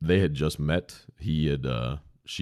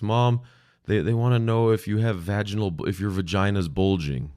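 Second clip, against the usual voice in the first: put-on higher, a bit louder child's voice relaying a question; little girl voice; 5 semitones higher, 3 dB louder than usual. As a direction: speak like a young girl calling out to her mom. Girl voiced asking mom.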